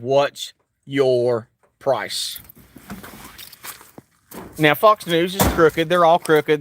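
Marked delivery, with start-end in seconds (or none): 0.0-2.4 drawn-out low menace; slow, low and pointed; slow, low question. Acted. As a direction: stretch every word, low and slow. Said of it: "That's called the example".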